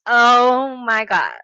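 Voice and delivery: drawn out in disbelief; stunned. Real, not acted. Creator recalling the comment, stunned and slow.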